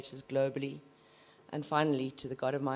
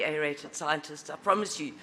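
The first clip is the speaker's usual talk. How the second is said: ordinary talk, nothing marked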